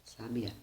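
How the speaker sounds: softly sa-bi-Á; stress on last syllable. Shown as a demonstration. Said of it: "Se na terceira, no último A".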